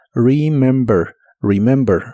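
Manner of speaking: "Remember" slowly, soft English r, first e as short i; "remember" con r suave; soft r demo. Shown as a demonstration. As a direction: ease gently into the r of "Remember". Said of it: "mucho más suave".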